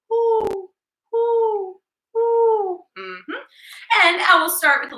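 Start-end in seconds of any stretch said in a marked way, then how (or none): 0.1-2.8 high hooting owl calls. Shown as a demonstration. Teacher to kids, high owl hoots. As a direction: hoot like an owl. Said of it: "Owls say".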